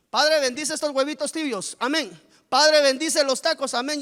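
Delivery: mock mealtime grace. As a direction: rattle off a hollow grace like a habit. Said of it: "solo para la comida".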